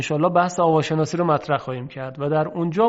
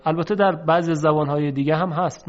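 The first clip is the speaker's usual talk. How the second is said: ordinary delivery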